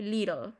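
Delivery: "Little" with the T as a quick r; American "Little", like "liRol"; American tapped T. Shown as a demonstration. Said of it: "se utiliza la R".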